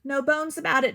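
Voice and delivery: plain reading voice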